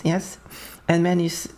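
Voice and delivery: usual speaking voice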